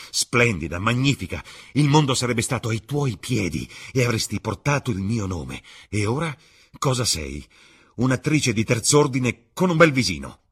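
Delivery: loud, high angry tirade; scornful outburst. A narrator's reading. Dorian throwing Sibyl's lost glory at her, angry and scornful. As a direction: build it to a loud, contemptuous finish.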